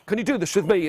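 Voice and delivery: ordinary delivery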